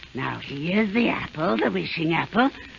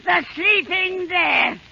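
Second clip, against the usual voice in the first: gloating incantation; 8 semitones higher than usual. Acted. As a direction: savor each doom. Witch gloating spell.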